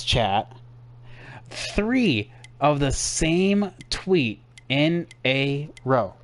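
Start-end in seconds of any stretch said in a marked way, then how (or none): 1.5-6.1 drawn-out, word-by-word disbelief; slow exasperation. Real, not acted. Streamer showing chat the same tweet three times, slowly exasperated.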